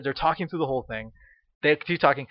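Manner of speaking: usual speaking voice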